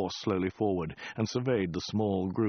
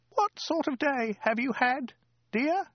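high falsetto, rising on the last word; querulous woman. A narrator's reading. Woman to the Khan as ordered, querulous high voice. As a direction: ask it in a fretful squeak. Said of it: "she asked querulously".

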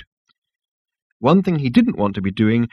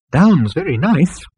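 slow, lilting hopeful suggestion; hopeful elevator coaxing slowly; 6 semitones higher, 3 dB louder, slower than usual. A narrator's reading. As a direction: suggest it hopefully, drawing it out with higher pitch. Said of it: "suggested the elevator hopefully".

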